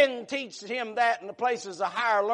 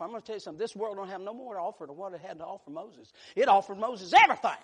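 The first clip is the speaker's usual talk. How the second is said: ordinary talk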